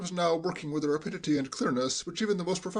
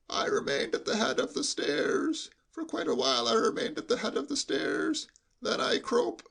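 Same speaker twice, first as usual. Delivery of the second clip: hushed, high sheep-like bleat; lowered bleating voice; 9 semitones higher than usual. Acted. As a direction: bleat it confidentially, as through cotton wool. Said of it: "in a lowered voice".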